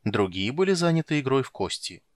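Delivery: ordinary delivery, nothing marked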